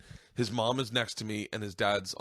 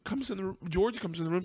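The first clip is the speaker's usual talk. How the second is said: high, strained voice on the edge of sobbing; tearful, cracking high-pitched retelling; choked up; 11 semitones higher than usual. Real, not acted. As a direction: push the story out through real tears. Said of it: "I didn't think I'd get emotional".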